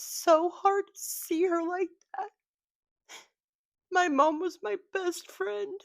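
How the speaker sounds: tearful, voice pitched high; crying. Real, not acted. Daughter remembering her late mom on camera, crying. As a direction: break into tears, words squeezed high. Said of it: "sorry for crying like this".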